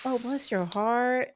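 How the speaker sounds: slow, drawn-out mock pity with a side eye; slow Southern put-down to a Northerner; side-eye bless your heart. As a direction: drag it out sweetly while judging. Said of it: "and like".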